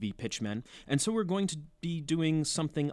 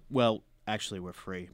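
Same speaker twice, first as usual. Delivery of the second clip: ordinary talk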